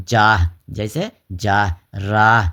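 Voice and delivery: slow جاہ and راہ with an audible breath of "h" at the end; teacher demonstrating the h in جاہ and راہ; sounded final h. Shown as a demonstration. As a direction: stretch the "aa" in جاہ and راہ, then voice the closing h. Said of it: "ادا کرتے وقت میں ہا جو ہے وہ تلفظ میں آ رہا".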